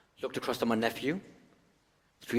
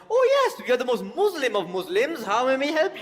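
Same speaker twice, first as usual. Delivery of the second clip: high, loud sneering mimicry; mocking the man; 14 semitones higher, 8 dB louder than usual. Retelling how the man took it, high loud mocking. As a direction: sneer it in a mock-friendly voice. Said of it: "He took my niece's genius intervention of".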